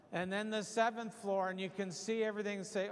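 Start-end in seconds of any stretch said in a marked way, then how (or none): none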